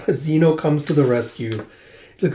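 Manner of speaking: ordinary delivery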